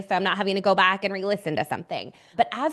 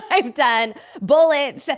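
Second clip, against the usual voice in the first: laughing; 6 semitones higher, 6 dB louder, slower than usual. Real, not acted. Laughing at own list.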